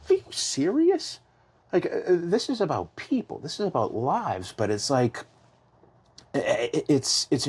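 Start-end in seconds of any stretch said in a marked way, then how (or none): 0.1-4.5 much higher, outraged "are you serious"; indignant disbelief. Retold or imitated. Father hearing the governor's remark, in indignant high disbelief. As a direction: voice the stunned, hurt father. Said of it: "'cause he's like".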